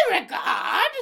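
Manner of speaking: high, mock British accent; hammed-up British butler call, R dropped. Shown as a demonstration. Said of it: "say it in this British accent".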